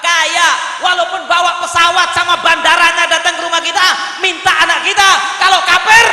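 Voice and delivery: loud, high sermon delivery; full-force preaching. Real, not acted. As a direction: preach it hard to a crowd.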